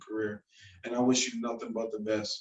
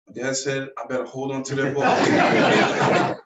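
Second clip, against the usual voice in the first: laughing; 7 dB louder than usual. Retold or imitated. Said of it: "He's like".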